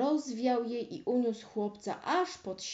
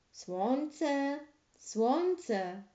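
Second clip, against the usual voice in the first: calling to the sun; 5 semitones higher than usual. A narrator's reading. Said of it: "zawołał więc".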